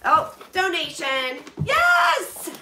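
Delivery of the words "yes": a sudden, very high, loud yell of delight; joyful shout. Real, not acted. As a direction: cheer out loud like you just won. Finds the box is a donation.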